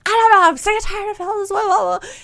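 high, loud, fast frustrated wail; upset outburst. Retold or imitated. Wife retelling her tearful outburst to husband, high wail. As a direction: let it burst out, fed up.

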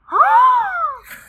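sharp loud high gasp of shock. Retold or imitated. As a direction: gasp as if struck by the news. Shocked at being told to leave.

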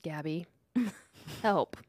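whispered plea with a laugh, slow; whispering. Pretend. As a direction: whisper for help, half-laughing. Pleading whisper to engineer.

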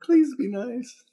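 high, drawn-out mock begging; mock pleading. Suggesting a begging text back to her, mock pleading. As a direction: plead in a high, slow put-on voice. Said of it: "pleading, begging type text".

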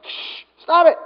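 shush, then a high scolding order; stern hushing. Acted. Crowd silencing the beggar, stern hushing. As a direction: hush him and tell him off. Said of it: "many were sternly telling him to be quiet".

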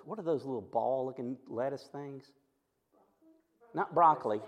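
normal talking voice

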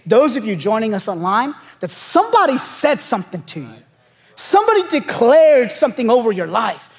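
impassioned preaching. Real, not acted. Pastor telling congregation God brought them here, impassioned preaching. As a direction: press it home to the congregation.

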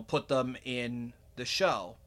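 normal talking voice

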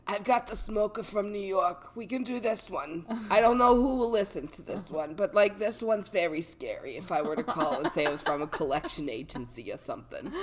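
put-on New York smoker accent. Shown as a demonstration. Showing off other accents, New York smoker voice. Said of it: "I have other accents".

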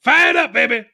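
high, loud hype shout; hyped up. Real, not acted. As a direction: pump the crowd up. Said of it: "I'm fired".

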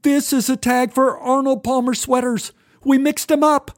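put-on high, panicky teen voice; panicked. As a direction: rush the explanation anxiously. Boy explaining the mistake, panicking.

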